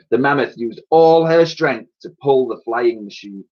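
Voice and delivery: ordinary delivery, nothing marked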